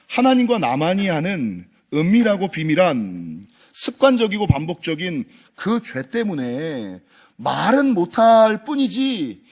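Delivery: ordinary delivery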